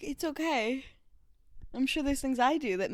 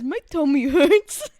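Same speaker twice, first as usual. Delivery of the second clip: slow, louder pouty complaint; mock whiny; 3 semitones higher, 10 dB louder than usual. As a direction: mimic a whiny kid. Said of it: "someone who says".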